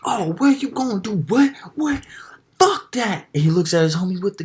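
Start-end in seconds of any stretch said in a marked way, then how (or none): 0.0-3.3 high, loud mock goading; loud hyped taunting. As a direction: imitate a little guy getting loud and hyphy. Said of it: "getting hella loud".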